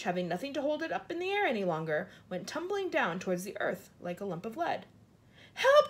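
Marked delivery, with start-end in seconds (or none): 5.5-5.9 high, loud cry for help; panicked cry. A narrator's reading. Centipede calling for help as the peach falls, panicked cry. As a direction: cry out in alarm. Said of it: "cried the centipede".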